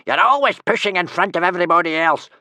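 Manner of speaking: high, loud complaint; angry roar. A narrator's reading. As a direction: roar your grievance at a pushy rival. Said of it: "roared Tough Nut Senior".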